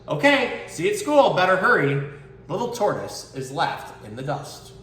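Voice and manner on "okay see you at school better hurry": high bright goodbye; snail cheery. Acted. Snail says goodbye to little tortoise, high cheery voice. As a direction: cheerfully leave the tortoise behind.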